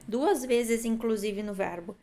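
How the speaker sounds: ordinary talk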